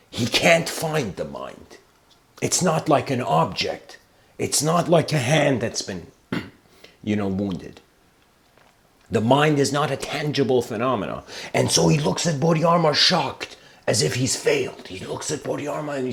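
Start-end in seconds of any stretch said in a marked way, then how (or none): none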